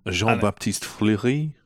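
Jean-Baptiste Fleury said slowly as in French, with a French r and a rise on Fleury; French accent. Shown as a demonstration. Co-host says the studio head's name in a French accent. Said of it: "sounds better if you say his name in a French accent".

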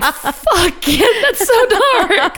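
high, drawn-out laughing dismay; mock-appalled reaction. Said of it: "And I was like".

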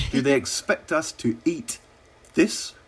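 slow, incredulous build to a high final word; retold disbelief, pitch climbing at the end; mock indignation. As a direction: quote an affronted diner, dragging out the complaint. Quoting Sarkozy disgusted at his steak.

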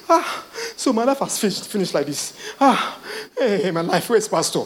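a hammed-up wail of a believer who thinks life is over; mock the panicking believer, half laughing; laughing mock lament; mock despair. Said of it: "mocking a despairing believer".

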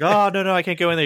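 high-pitched anxious teen imitation; hammed-up panicky reluctance; mock nervous refusal. Acting out anxious Wart's refusal.

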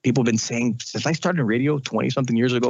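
normal talking voice

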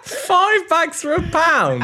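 high, slow and laughing; can't believe the price, cracking up; five bags for a pound, laughing in disbelief. Real, not acted. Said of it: "I've just got to stop it to have a laugh".

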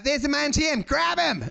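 high, eager call; rally the crowd to grab him; excited shout. Pretend. Pub drinker spotting the human ATM, high excited call.

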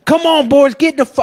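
high, loud mock yell at the boys. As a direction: bark it like a pushy host. Wayne yelling at guys.

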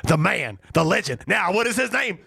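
playful, high-pitched fanfare; mock hype introduction. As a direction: pitch climbing to a high announcer build-up. Hyping the introduction of a co-host.

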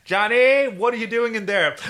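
high, loud hammed-up calling voice; put-on high suspicious holler; mock parent calling. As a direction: call through a door like a nagging parent. Mock parent calling out, joking aside.

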